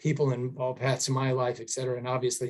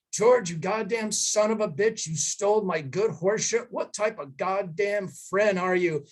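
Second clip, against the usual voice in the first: retold rant; 6 semitones higher than usual. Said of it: "completely go off on my dad".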